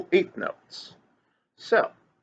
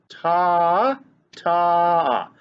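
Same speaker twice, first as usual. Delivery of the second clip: held ta; 4 dB louder than usual. Shown as a demonstration. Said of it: "we go ta for each half note".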